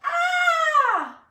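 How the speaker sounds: piercing high loud scream. Acted. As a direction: scream from a burning-hot bite. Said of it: "Zach screamed".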